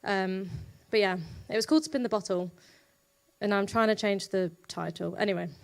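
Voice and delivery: usual speaking voice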